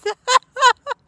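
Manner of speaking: very high burst of giggles. Pretend. Laughing at cat.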